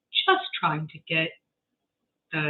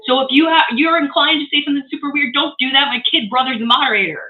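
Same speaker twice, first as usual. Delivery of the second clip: higher, louder stern warning; emphatic warning; 6 semitones higher, 5 dB louder than usual. Real, not acted. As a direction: warn them firmly with a raised voice. Warning chat not to be weird.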